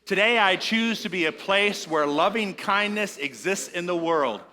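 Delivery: preacher's call and response; leading a group affirmation. Shown as a demonstration. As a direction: say it out for all to echo. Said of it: "Say that with me, please".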